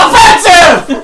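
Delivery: slow loud echo, laughing; laughing echo shout. Acted. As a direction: drag out the shouted word. Echo shouting a repeated word.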